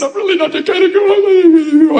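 preacher voicing a divorced lady's confession, high and pitiful; imitating the lady. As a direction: squeak it out high, playing her confession. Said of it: "So she leaned into me and said".

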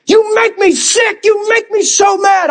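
high, raging rant acted out; re-enacted angry yelling. Pastor replaying his teenage tirade at the Bible, angry yelling. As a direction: yell it like someone you hate. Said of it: "I yelled at it like it was somebody I hated".

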